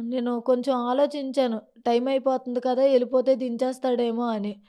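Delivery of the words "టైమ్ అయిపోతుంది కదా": normal talking voice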